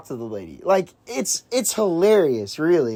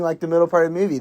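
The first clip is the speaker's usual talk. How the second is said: ordinary delivery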